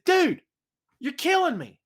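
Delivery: high, exasperated yell; exasperated call. Retold or imitated. Telling off the drunk neighbour, a high exasperated yell. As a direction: cry out at him, fed up.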